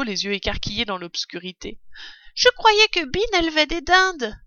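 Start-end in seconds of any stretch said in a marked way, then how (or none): 2.3-4.4 high-pitched cub voice; puzzled young fox cub. A narrator's reading. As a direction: wonder aloud in a raised little voice. Voice of a story figure. (voix haute de renardeau étonné)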